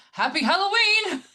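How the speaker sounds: high, loud, hammed-up Happy Halloween; cheery shout. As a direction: belt it out like a cheesy party host. Tagline read with big pitch jump.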